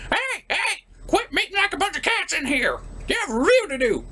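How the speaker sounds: put-on high, nagging old-man yell; grumpy Grandpa. Grandpa scolding the reviewer to get back to work, high and nagging. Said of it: "Okay, okay, Grandpa".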